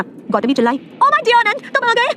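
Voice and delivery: high-pitched gushing cry; thrilled, drawn-out high greeting; excited greeting. A narrator's reading. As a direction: squeal it to a long-awaited beloved. Delighted outburst greeting her beloved.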